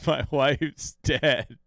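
slow, heavy mock-solemn line; imitating Finlay's slow comeback; deadpan retort. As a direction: drop it slowly like a trump card. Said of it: "He says".

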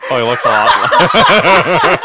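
breaking up laughing mid-sentence. Real, not acted. Realizing the lookalike.

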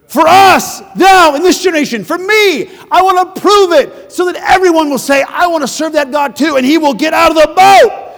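rising to an impassioned shout; zealous declaration. Retold or imitated. Preacher voicing a bold believer, impassioned shout. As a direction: preach it like a man on fire for God.

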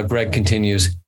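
ordinary delivery

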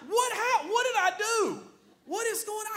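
high, loud bewildered husband voice; mock-confused husband with a laugh; clueless husband. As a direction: play the baffled husband, pitch way up. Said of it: "And I'm like".

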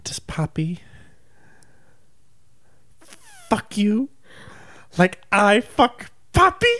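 whispered start rising into a high loud taunt; slowly twist the knife; creeping whisper to shrill jab; taunting rival. Retold or imitated. Tanya's taunting reveal.

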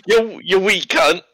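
high, slow Boston accent attempt; hammed-up accent with the voice cracking; cracking-voiced accent impression. Game priest insulting a traveler, cracking mock Boston accent. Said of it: "the cracking in the voice is perfect".

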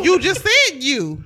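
loud, slow, accusing; slow it down and accuse; indignant. Real, not acted.

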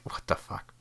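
a bewildered whisper to yourself. Retold or imitated. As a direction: whisper it, baffled. Baffled at the mayonnaise glob.

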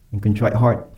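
ordinary delivery

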